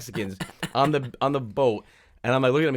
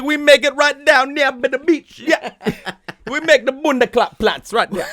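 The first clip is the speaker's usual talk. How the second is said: put-on high, loud, sing-song accented voice; mock island hawker; 11 semitones higher, 5 dB louder, slower than usual. Island dealer touting the beach, hammed-up high sing-song. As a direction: chant it loudly through laughter.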